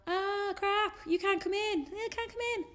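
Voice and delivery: high-pitched flustered "you can't come in"; mock panicked friends. Friends at the window telling Ruth to stay out, high and flustered. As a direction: squeal it like a friend who can't let you in.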